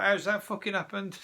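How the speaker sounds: high-pitched incredulous question; acted disbelief, pitched well up; mock bafflement. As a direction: play a baffled punter, voice jumping up. Imagined baffled festival-goer leaving sober.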